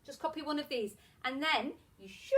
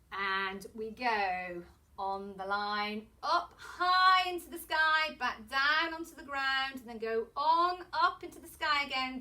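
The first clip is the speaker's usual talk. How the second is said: handwriting patter; 4 dB louder than usual. Shown as a demonstration. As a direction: stretch each word to match the moving pencil. Chants letter formation while demonstrating.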